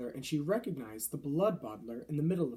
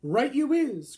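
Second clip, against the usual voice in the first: loud, higher gleeful cry; cried out; 10 semitones higher, 9 dB louder, slower than usual. A narrator's reading. Bloodbottler backing up the accusation, crying out. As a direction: jump in eagerly with the accusation. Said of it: "cried the blood bottler".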